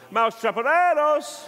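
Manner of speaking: high, loud, slow hammed-up Mexican shout; mock Mexican accent. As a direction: stretch it out like a cartoon Mexican. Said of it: "it was a Mexican fella".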